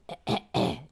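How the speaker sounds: stagey throat-clearing; preparatory cough. Acted. Host preparing to perform a French line, theatrical cough. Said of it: "Coughs to prepare".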